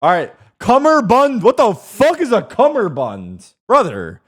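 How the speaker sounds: slow, loud disbelief; loud outraged. Real, not acted. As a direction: bellow it, high and slow. Outraged at odd word prompt.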